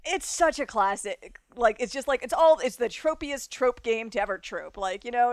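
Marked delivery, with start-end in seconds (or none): none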